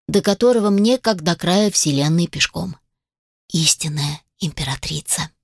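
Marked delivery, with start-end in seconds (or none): 3.5-5.3 hushed whisper. A narrator's reading. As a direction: breathe it out in awe. Narrator's awed aside.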